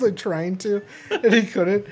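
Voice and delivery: ordinary talk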